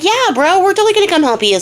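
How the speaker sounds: high, fast dude-bro cheer; jumping at the chance to help, high and fast; eager bro townsfolk. Retold or imitated. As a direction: hype up like eager village bros.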